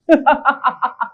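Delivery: steady run of chuckles; gloating laugh. Acted. Man mocking being called strange, gloating laugh. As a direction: laugh at the irony.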